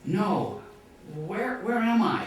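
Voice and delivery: high, loud, slowed desperate shout; yelling back down. Retold or imitated. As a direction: plead loudly, frustrated. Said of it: "And he yells back down".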